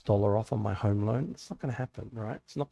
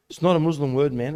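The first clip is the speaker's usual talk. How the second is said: louder, higher retold objection; protesting retort; 3 semitones higher, 9 dB louder, faster than usual. Cafe owner correcting a remark about his name, protesting. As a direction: imitate someone loudly setting the record straight.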